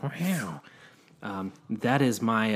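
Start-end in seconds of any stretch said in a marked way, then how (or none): 0.0-0.6 high-pitched imitated actor's wow; Owen Wilson. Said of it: "Owen Wilson voice".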